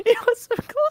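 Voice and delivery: very high cartoon voice; high squeaky voice. Squeaky mock dealer voice.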